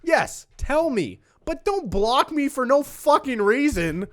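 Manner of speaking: high, slow, emphatic protest; exasperated rant. Real, not acted. Venting to co-host about being blocked, exasperated rant.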